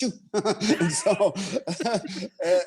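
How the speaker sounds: laughing through the words; laughing at the memory. Real, not acted. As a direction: chuckle while telling it.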